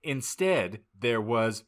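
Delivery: ordinary talk, nothing marked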